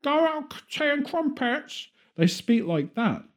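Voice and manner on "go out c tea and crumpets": high-pitched mock Cockney caricature; fake British accent. Mocking how Americans think Brits talk, exaggerated British accent. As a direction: squeal it like an American's idea of British. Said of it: "that's the accent they think".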